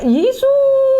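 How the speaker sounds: jeritan tinggi dan keras; teriak Yesus. Retold or imitated. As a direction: jerit Yesus penuh panik. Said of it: "waktu saya teriak". (teriakan panik memanggil Yesus)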